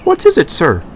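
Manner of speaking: eager question. A narrator's reading. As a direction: hang on the boss's every word. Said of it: "asked Dexter".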